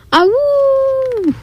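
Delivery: high howl sliding down; wolf howl. A narrator's reading. The wolf howling at the moon, high howl. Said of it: "wolf howling at the moon".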